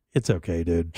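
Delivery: low, unhurried, relaxed murmur; very mellow. Retold or imitated. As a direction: drop the voice low and lazy like you're floating. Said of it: "it was just very mellow".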